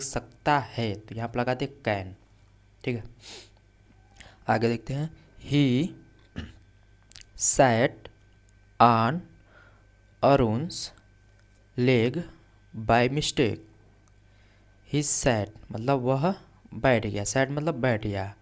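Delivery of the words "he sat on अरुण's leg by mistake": word by word dictation. Shown as a demonstration. Teacher dictates English sentence word by word.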